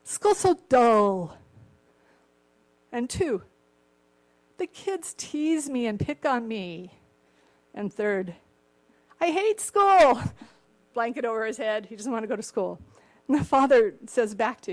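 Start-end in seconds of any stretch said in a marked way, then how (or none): 0.0-10.2 slow, louder sulky whine; whiny son. Retold or imitated. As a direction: drag out each complaint like a sulking kid. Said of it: "He goes".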